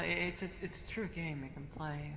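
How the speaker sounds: ordinary delivery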